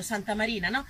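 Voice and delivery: usual speaking voice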